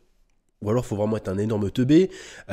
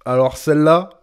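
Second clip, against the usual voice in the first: louder, higher incredulous repeat; rising disbelief; 4 semitones higher, 8 dB louder than usual. Real, not acted. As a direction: build the disbelief, voice lifting and louder. Escalating incredulous reaction, second take. (incrédulité qui monte)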